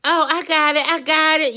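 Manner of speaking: mimicking the friend's high, loud brush-off; dramatic friend. Friend dodging her about the money, hammed-up high and loud. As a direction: play the friend all over the place, high and loud. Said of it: "And she's like".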